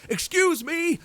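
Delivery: high calling yell. A narrator's reading. Narrator chasing the man, yelling high. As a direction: shout after him to stop him. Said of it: "I yelled".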